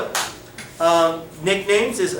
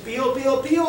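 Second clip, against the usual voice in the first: higher sing-song pío, pío; chick peep; 5 semitones higher, much faster than usual. Shown as a demonstration. Teacher quizzing children on animals, chirping the chick sound higher.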